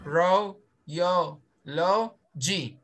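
ro, yo, lo, g split into separate beats; syllable by syllable. Shown as a demonstration. Teacher breaks the word into syllables for the student. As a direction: tap out each syllable slowly. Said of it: "You count it when you say met".

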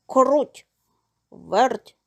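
rooster's firm call to friends. A narrator's reading. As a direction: call them over, each name on its own. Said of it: "став гукати півник".

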